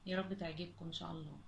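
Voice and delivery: ordinary talk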